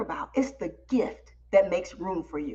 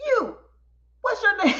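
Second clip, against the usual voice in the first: host calling someone out; 10 semitones higher than usual. Retold or imitated. Host calling out the hiding speaker.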